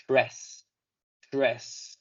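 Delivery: "tres" said alone, first syllable of interesting; isolated syllable. Shown as a demonstration. Teacher isolating the syllable "tres". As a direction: say just "tres" clearly. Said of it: "se van a pronunciar únicamente como".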